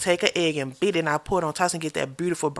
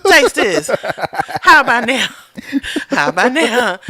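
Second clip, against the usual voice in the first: loud, laughing, pushing a taste; pushy loud insisting; 6 semitones higher, 11 dB louder than usual. Retold or imitated. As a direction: shove the spoon at him laughing. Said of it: "use me as a crash test dummy".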